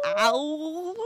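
"Ow" echoed loud and high, sliding up; exaggerated, high-pitched "Ow"; phonetic Cockney cry. Shown as a demonstration. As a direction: yowl "Ow" with a rising swoop. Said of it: "pronounce it back at me phonetically".

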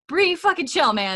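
high, loud plea to ease off; exasperated yell. Real, not acted. Host telling Bree to chill, exasperated and loud.

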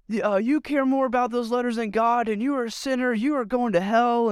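mock hellfire preacher. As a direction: ham up a street preacher's condemnation. Street preacher condemning, retold.

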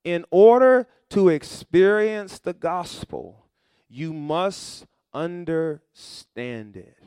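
slow, loud, every word weighted; slow emphatic repeat. Shown as a demonstration. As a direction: drag it out so it sinks in. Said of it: "point repeated slowly".

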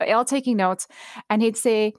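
usual speaking voice

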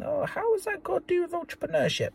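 high, doubting listener voice; a lightly mocked sceptic asking why; imitating a doubting listener. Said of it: "some of you might be thinking".

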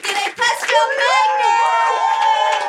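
high, shouted game-show hype for the prize; excited game-show announcer reveal; hyped prize announcement. Acted. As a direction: sell the giveaway like a TV host, wait wait wait. Host hypes prize giveaway.

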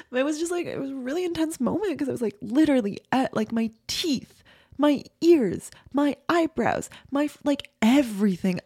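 high, tearful voice, really upset; crying. Real, not acted. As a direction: push the words out through tears. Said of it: "I'm crying".

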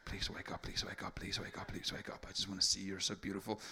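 hushed, adoring dad's whisper; pleading whisper. Retold or imitated. Dad staring at his sleeping little girl, soft pleading whisper. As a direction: whisper it eagerly, careful not to wake her.

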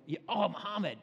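a light mock cry of pain; mock pained exclamation. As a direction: pretend you just hit your hand. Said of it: "and you go".

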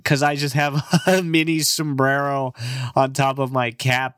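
ordinary delivery

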